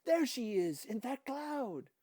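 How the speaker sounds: high, loud excited shout. Acted. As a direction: yell it out like an eager kid. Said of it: "Dika shouted".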